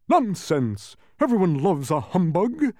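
high, loud blustering protest; shouting. A narrator's reading. Humbug objects to the bee, shouted bluster. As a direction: shout down the insult. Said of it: "shouted the humbug".

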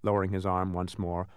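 ordinary delivery, nothing marked

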